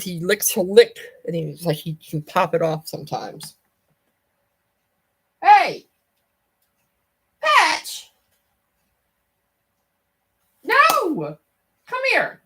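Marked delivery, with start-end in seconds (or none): 5.4-12.4 loud, much higher shout at a pet; high shouted no at the cat; calling the cat. Real, not acted. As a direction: stop the cat mid-mischief with a yell.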